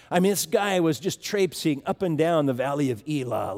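ordinary talk, nothing marked

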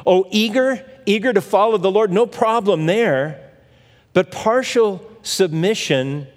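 usual speaking voice

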